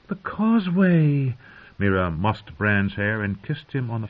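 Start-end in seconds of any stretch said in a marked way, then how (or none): 0.1-1.4 high, slow exclamation; delighted realisation. A narrator's reading. Mira struck by Bran's idea, exclaiming delightedly. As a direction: draw it out with delight.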